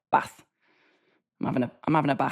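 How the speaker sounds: put-on bath with a short flat a, Derby accent. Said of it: "I'd be like".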